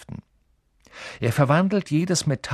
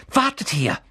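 urgent; 5 semitones higher, 3 dB louder than usual. Acted. Hermine dashes off excitedly.

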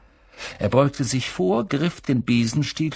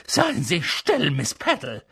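stern professor; 3 semitones higher than usual. A narrator's reading. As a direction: cut the pupil off, measured and strict. Professor silences a pupil. (strenge Lehrerinnenstimme)

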